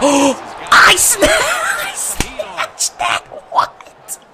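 gasping, breaking into high voiceless squeal; strangled, laughing outburst; incredulous. Real, not acted. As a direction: choke out the words in slow disbelief. Disbelief at picked-off pass.

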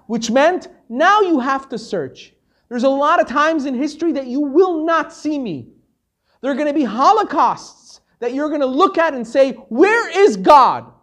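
rising preacher's outcry, from firm to anguished; building to an outcry. Acted. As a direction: climb until you cry out to God.

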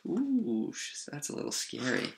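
hushed, playful shiver; soft, high "ooh" sinking to a hush; mock fright. Reader reacting to the growly wolf, playfully scared.

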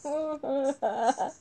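startled laughing. Real, not acted.

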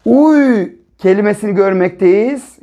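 normal talking voice

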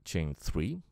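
ordinary talk

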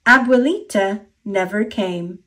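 usual speaking voice

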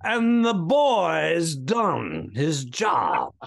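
put-on slow, drawn-out New England accent; thick New England accent. Co-host shows off his accent popping out, broad New England. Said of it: "did you see the accent on that guy?".

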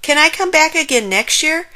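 loud, high, quick plea; cheering. A narrator's reading. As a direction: rattle it out with thrilled eagerness. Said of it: "cheered Frog".

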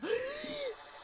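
high, sharp intake of breath; sudden startled gasp; shocked gasp. Real, not acted. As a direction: gasp in alarm. Sees gnomes followed them.

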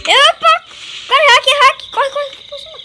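high panicked yelling; being chased. Real, not acted. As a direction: scream to run while chased. Enemy chasing them in game.

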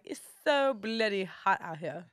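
slow, drawn-out mock-British; British accent. Rapper imitating Londoners for host, British accent. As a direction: put on a playful London accent. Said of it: "I like saying the bloody part".

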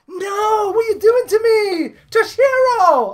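high, drawn-out protest; exasperated wail. Real, not acted. Player protesting another three star, exasperated wail. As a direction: wail at the game in disbelief, high.

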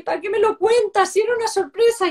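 put-on high, loud, tearful child's whine with a laugh; imitating crying child. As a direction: mimic a little boy crying and laughing at once. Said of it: "ha sonreído y empieza a llorar y me dice". (imitando a un niño llorando, agudo y fuerte)

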